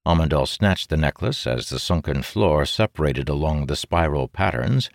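ordinary delivery

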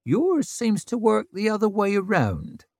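high, dry teasing voice; deadpan jab at her friend; dry wry woman. Acted.

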